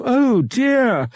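high, loud dismayed wail; gasped exclamation. A narrator's reading. Mother in her dressing room, gasped exclamation. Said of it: "gasped exclamations".

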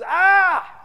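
high, very loud acted shout; loud cry of alarm. Editor startles his whole office, loud alarmed yell. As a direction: let out a sudden held 'Ah!'.

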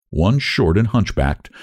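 plain reading voice

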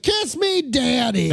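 very high, strained imitation yell; forced yell. Older winner giving in to the crowd, high mock yell. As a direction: squeal out the embarrassing phrase. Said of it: "you have to yell".

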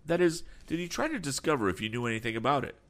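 put-on eager young voice, words rushed fast; hurried young Tom. A narrator's reading. Tom presses the captain about Berg, rushed and eager. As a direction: press the question quickly, keen to know.

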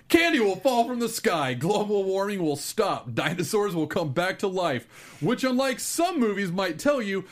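monster truck ad. Acted. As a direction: sell it like a monster truck rally. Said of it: "announcing a monster truck rally".

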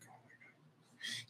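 whispered, slow and drawn out; dismayed whisper. Retold or imitated. Buddy whispering in dismay at the new private. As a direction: breathe it out in disbelief.

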